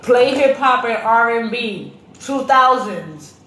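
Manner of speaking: calling out slow and loud to the speaker; loud command to Alexa. Retold or imitated. Mom commanding her Alexa, slow and loud. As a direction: spell it out loudly for the device. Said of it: "and I be like".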